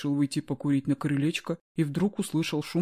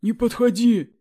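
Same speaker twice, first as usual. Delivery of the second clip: high, loud panicked yell; 9 semitones higher, 8 dB louder, slower than usual. Acted. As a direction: shout him away in alarm. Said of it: "заорал Юрий".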